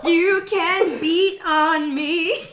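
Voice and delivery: drawled out slowly through real laughter; laughing slow line. Real, not acted. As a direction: stretch each word while cracking up. Joking along with the lyric.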